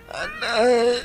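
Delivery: high pained cry of ouch. Acted. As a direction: wince out the word in pain. Father in pain. (mataas na daing sa sakit)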